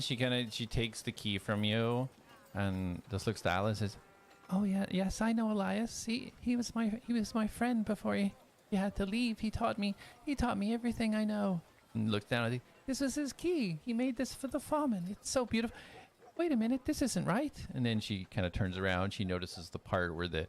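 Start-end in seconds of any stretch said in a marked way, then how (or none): 4.5-11.6 put-on high young woman's voice, fond and sad; high-pitched apprentice recalling her teacher; Jonas, tearful and wistful. 12.9-17.6 put-on high young woman's voice, fond and sad; high-pitched apprentice recalling her teacher; Jonas, tearful and wistful. Jonas admires then notices.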